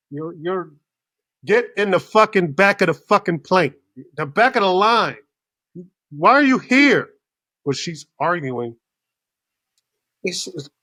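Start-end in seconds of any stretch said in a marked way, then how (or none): 1.4-7.1 loud, high mock scolding; incredulous retold rant; exasperated outburst. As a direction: act out fed-up yelling at a passenger. Acted outburst at line-holding passenger.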